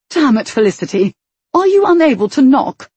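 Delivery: exasperated, rising to a yell; annoyed outburst. Acted. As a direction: scold her loudly. Brother annoyed at intrusion.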